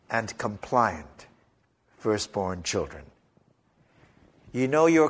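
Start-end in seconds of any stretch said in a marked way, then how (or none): 0.1-3.0 softer, slow and yielding on compliant; meek. Shown as a demonstration. Preacher describing compliant firstborns, meek and soft. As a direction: sound mild and obedient. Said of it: "They come in two varieties".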